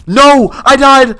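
high, loud cry of dismay; sudden loud outburst of frustration; dismayed yell. Real, not acted. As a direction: yell out in shock at losing. Dies in the game.

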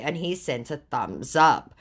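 usual speaking voice

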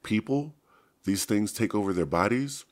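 high, loud shocked question; horrified. A narrator's reading. Rachel realizes the Yeerks take over people, horrified cry. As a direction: recoil in disbelief.

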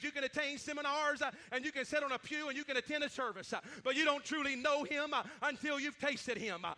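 ordinary delivery

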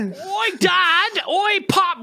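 loud, high mock London accent; bad London accent. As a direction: holler in a hammed-up South London accent. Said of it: "so bad at the fucking accent".

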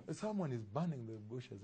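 normal talking voice

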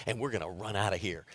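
low, hushed and quick, plotting; hushed plan. Retold or imitated. Father quietly plotting the tip with his son, hushed and quick. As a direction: whisper the scheme to your son fast. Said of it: "I said".

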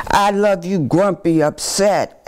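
ordinary delivery

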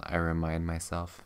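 ordinary delivery, nothing marked

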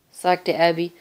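usual speaking voice

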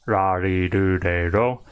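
ra, ri, ru, re, ro with each R tapped like a soft D; even chant of ra, ri, ru, re, ro, R flicked like a light D; soft Japanese R. Shown as a demonstration. Teacher demonstrating Japanese R for learners, soft tapped R. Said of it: "it sounds like a soft D".

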